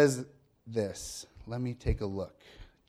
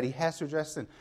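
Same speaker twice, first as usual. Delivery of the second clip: ordinary delivery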